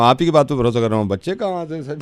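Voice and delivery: ordinary talk, nothing marked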